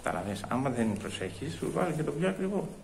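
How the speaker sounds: very soft, confiding grumble; muttered aside. Acted. Customer confiding that staff push pricier cheese, muttering. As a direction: drop the voice to warn a bystander.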